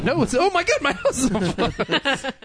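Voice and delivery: high, slow, laughing fake alarm; mock panic. Mock panic, second take.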